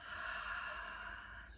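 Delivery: long, voiceless sigh through the mouth. Shown as a demonstration. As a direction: let a slow breath out audibly, demonstrating the exhale. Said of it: "sigh it out through the mouth".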